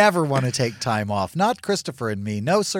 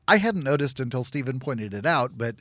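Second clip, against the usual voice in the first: ordinary delivery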